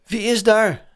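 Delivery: hoog, luid en een tikje bang geroepen; trillende roep. A narrator's reading. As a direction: roep geschrokken het donker in. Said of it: "riep hij met trillende stem". (met trillende stem roepen)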